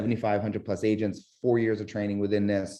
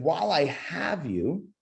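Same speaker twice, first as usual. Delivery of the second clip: elongated; 5 semitones higher, slower than usual. Shown as a demonstration. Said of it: "If you also hear".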